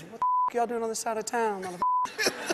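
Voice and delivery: high, shouted challenge; aggressive gang leader. Retold or imitated. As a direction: confront the intruders, high and hostile. Gang leader confronts them aggressively.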